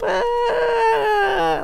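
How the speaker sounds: very high panicky squeak; panicked squeal. Retold or imitated. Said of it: "sort of panics".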